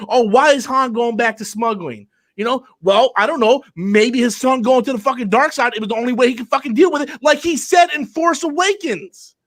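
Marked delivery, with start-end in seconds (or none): 5.7-9.4 heated shout. Real, not acted. Heated Star Wars rant.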